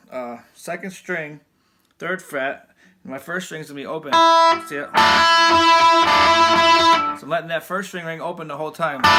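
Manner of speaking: ordinary delivery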